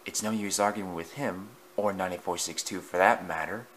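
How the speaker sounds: the muttered warning goes on, softly; muttering. A narrator's reading. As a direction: say it under your breath.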